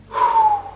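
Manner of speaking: sharp, high startled gasp. Retold or imitated. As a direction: suck in air in a quick high gasp. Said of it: "so I was like".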